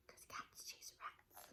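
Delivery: slow unvoiced whisper. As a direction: hiss it as a secret. Whispered aside.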